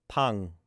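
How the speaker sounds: "pang" ending in a clear NG as in sing. Shown as a demonstration. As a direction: close "pang" on the NG sound. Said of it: "N G sound like in sing".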